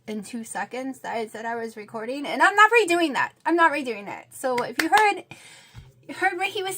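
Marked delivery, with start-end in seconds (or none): 2.3-4.3 firm refusal. Real, not acted. Annoyed refusal to re-record.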